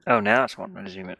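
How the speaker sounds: normal talking voice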